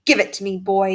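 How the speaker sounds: usual speaking voice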